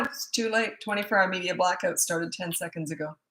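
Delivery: ordinary delivery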